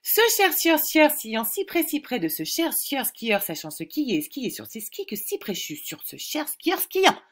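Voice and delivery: quick, in one breath; tongue twister speeding up. Shown as a demonstration. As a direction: rattle it off fast in one breath. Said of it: "je vais essayer de vous le dire un peu plus vite". (virelangue dit vite d'une traite)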